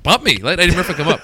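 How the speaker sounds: high, loud eager call; comic begging to go after Eddie Murphy; eager plea. Retold or imitated. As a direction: beg them to bump you, loud and keen. Eager comic pleading loudly.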